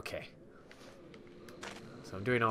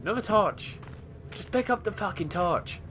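frustrated shout; 10 semitones higher, 5 dB louder than usual. Real, not acted. Frustrated at game pickup.

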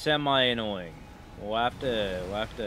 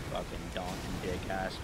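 ordinary talk, nothing marked

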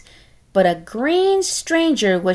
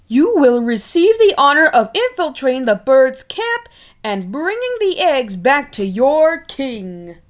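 louder, higher pompous decree; royal proclamation; 4 semitones higher, 4 dB louder than usual. Acted. King assigning Tiny Pig, pompous loud decree.

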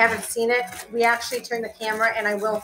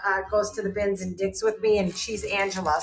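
normal talking voice

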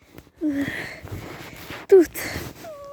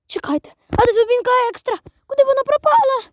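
usual speaking voice

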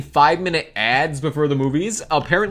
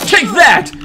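shouted taunt; loud taunt; 10 semitones higher, 10 dB louder than usual. Acted. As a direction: yell it as you punch. Punching in the game.

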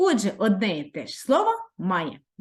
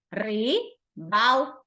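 "Рибалка" split slowly into its three syllables, ри-бал-ка; much slower than usual. Shown as a demonstration. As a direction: spell out "Рибалка" slowly, one syllable at a time. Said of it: "У слові рибалка три склади".

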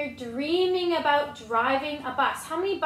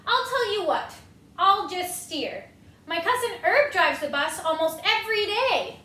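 pigeon bargaining; 4 semitones higher, 4 dB louder than usual. Acted.

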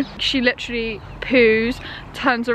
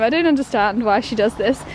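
ordinary talk

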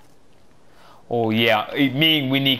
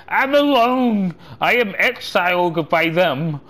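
high, loud hammed-up cry of woe; play-acted lament; mock wailing; 7 semitones higher, 4 dB louder than usual. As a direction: wail it melodramatically, drawn out then falling. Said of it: "sit down here crying. It's like".

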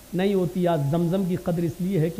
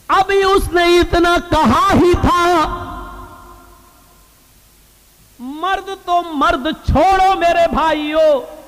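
high, drawn-out oratory; slow, ringing proclamation with raised voice; full-force preaching; 10 semitones higher, 7 dB louder, slower than usual. Real, not acted. Preacher to his brothers, telling of a prayer answered, full-force preaching.

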